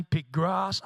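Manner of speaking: ordinary talk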